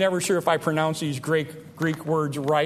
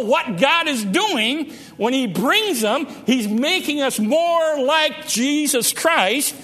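building sermon fervor; 6 semitones higher than usual. Acted. Preaching climax.

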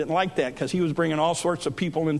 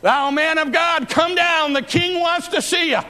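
high, ringing call up a hill; crying out; 9 semitones higher, 4 dB louder than usual. Retold or imitated. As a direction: holler the summons like an army captain. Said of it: "cried out the same thing".

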